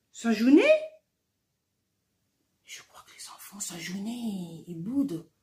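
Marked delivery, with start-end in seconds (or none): 2.6-5.3 whisper. Real, not acted. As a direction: lean in and whisper the secret. Confie aux enfants en aparté. (chuchoté aux enfants, en confidence)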